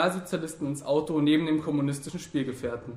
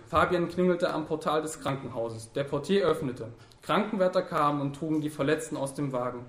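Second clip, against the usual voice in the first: plain reading voice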